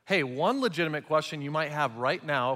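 ordinary talk, nothing marked

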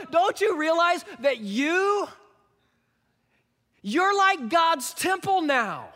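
high-pitched, exaggerated sarcastic appeal. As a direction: spell out the obvious, pitched well up. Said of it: "a little bit of sarcasm in his voice".

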